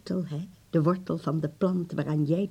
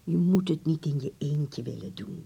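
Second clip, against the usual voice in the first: ordinary talk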